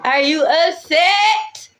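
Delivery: high, slow mocking tease; taunting singsong. Teasing a jealous rival, high drawn-out mockery. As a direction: draw it out to needle them.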